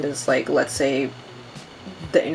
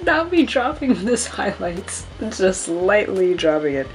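mock dramatic; 2 semitones higher than usual. Real, not acted. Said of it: "Sounded more dramatic though".

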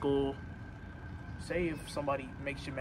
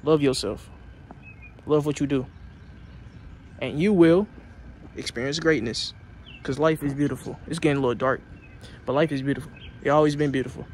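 loud, fired-up motivational speech; loud preaching pep talk; 9 dB louder than usual. Real, not acted. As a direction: pump them up like a coach.

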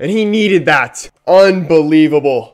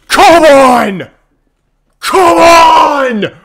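higher, louder roar; real shout; 10 semitones higher, 7 dB louder, much slower than usual. Real, not acted. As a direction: roar out the victory. Fan celebrating winner.

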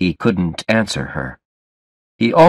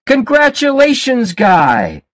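shouting congratulations. A narrator's reading. Party guests to the groom, shouted congratulations. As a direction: call it out like a wedding crowd. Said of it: "People were all shouting".

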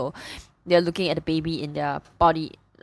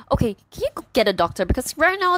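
urgent; 8 semitones higher, faster than usual. Retold or imitated. Husband urgently orders the footman.

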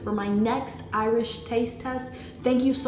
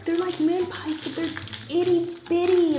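gushing; 10 semitones higher, 4 dB louder than usual. Real, not acted. Gushing over tiny cookies.

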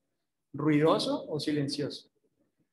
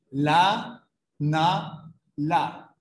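la, na, la spaced a second apart; evenly paced syllable drill; syllables one per second; 3 dB louder, much slower than usual. Shown as a demonstration. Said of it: "una por segundo".

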